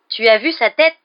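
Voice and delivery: high, loud gleeful question; delighted. A narrator's reading. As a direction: crow it with delight. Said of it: "d'un ton ravi".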